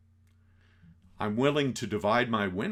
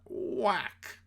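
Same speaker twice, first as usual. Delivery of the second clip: sound effect whack; 10 semitones higher than usual. Retold or imitated.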